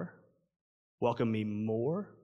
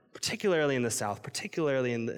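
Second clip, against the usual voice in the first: ordinary talk, nothing marked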